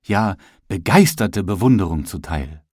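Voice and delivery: usual speaking voice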